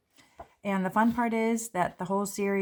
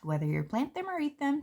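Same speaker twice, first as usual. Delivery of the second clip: ordinary talk, nothing marked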